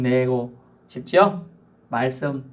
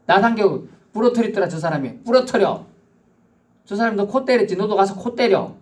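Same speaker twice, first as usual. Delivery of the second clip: higher and louder, pushing for payback; heated urging; 6 semitones higher, 3 dB louder than usual. Acted. Preacher acting out eye-for-eye revenge, higher and louder. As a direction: fire them up to hit back.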